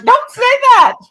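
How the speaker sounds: mock yelp of prim outrage; hammed-up scolding granny; scandalized mother-in-law. Mother-in-law scolding in retold story.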